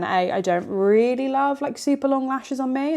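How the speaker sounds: ordinary delivery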